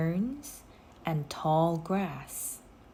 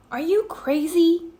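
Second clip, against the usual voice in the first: higher, loud alarmed call; 11 semitones higher, 7 dB louder than usual. A narrator's reading. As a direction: Jack calls after Annie going down, alarmed call. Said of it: "Jack called".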